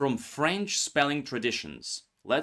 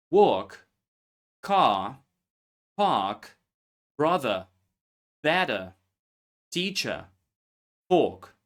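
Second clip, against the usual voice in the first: car, park, brother said without r, British style; British non-rhotic; 4 dB louder, slower than usual. Shown as a demonstration. Teacher demonstrates British English dropping r, clearly. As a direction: drop every r like a British speaker. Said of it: "R is often dropped in words like".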